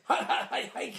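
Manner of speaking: unvoiced-sounding, laughing string of hikes; mimicking Brucey. Fan acts out Brucey's football scene, laughing mimicry. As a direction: imitate the goofy player through laughter.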